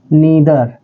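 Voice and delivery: नीदर with a long ee, not nye; say neether. Shown as a demonstration. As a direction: pronounce नीदर as nee-dher. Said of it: "लेकिन आप पढ़ना है नीदर". (नीदर को नी की तरह बोलें)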